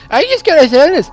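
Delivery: very high, loud mocking squeal; jeering, high-pitched loud imitation; mocking mimicry. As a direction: parrot a pleader in an exaggerated high whine. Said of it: "mimics the middle-aged fellow".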